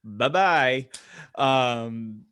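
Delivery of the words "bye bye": ordinary delivery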